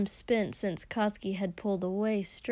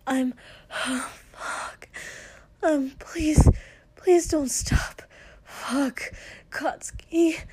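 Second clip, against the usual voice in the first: high, loud pleading cries; desperate breathy begging; breathless pleading; 5 semitones higher, 4 dB louder, slower than usual. Acted. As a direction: beg with rising urgency. Pleading in intimate scene.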